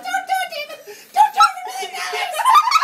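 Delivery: high falsetto shout imitating a protesting girl; frantic mock protest. Anna begging him to refuse the deal, frantic high mock shout. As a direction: squeal it out loud as a mock plea.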